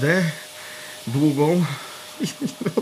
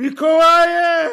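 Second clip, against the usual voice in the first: crying out in sleep; 11 semitones higher, much slower than usual. Acted.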